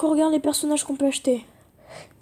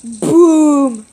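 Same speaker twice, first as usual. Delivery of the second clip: loud; 11 dB louder than usual. Real, not acted. Landing a big hit.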